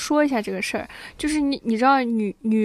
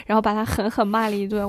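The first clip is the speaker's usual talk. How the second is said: ordinary talk, nothing marked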